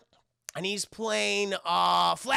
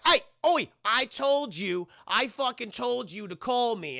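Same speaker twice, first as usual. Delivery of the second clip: raised, slow indignant reproach; acted telling-off; 5 semitones higher, slower than usual. John confronting the guard on the roof, acted telling-off. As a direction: call them out sharply, drawing out each word.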